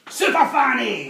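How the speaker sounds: hammed-up loud slow showgirl call, pitched high; loud mock cry. Jane flashing the old man, hammed-up loud cry. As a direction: throw it out like a big reveal.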